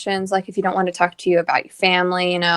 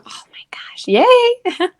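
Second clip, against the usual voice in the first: whispered cheer; slower than usual. Real, not acted. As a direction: breathe it out in a whisper. Whispered cheer about graduating.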